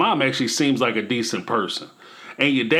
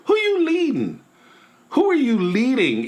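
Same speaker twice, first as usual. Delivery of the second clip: incredulous; 9 semitones higher than usual. Real, not acted. Scolding Cody's leadership.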